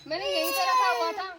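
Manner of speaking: high-pitched indignant outburst; angry insistence. Acted. Employer insisting she left pendant here, indignant.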